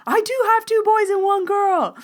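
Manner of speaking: very high, loud, amazed squeal; imitated freak-out. Man confirming his two boys and one girl, freaking out. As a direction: squeal it in mock astonishment. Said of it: "he was, like, freaking out".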